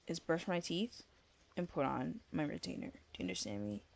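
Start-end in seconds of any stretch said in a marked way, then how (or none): none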